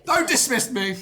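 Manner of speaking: loud, high-pitched outburst of protest; indignant shout. Real, not acted. Co-host cut off by the presenter, indignant shout. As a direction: yell it in mock outrage. Mock protest at being dismissed.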